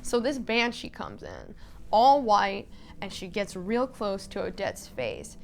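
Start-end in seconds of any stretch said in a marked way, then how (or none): none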